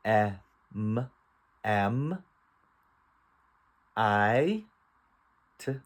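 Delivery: slow beginner-reader decoding; phonics sounding out. Shown as a demonstration. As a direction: separate sounds blended into "am", "top", "cat". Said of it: "Let's read".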